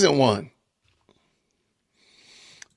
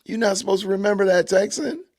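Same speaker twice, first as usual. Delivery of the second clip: playful scolding; 6 semitones higher than usual. Real, not acted. Repeat, teasing the chatter.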